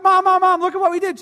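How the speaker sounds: put-on excited kids. Kids running to their texting mom, high and loud. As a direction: call out like eager children. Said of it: "And they're like".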